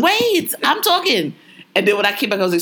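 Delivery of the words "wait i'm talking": retold cut-in, pitched way up; insistent interruption. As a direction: jump high to hold the floor. Repeats interruption much more insistently.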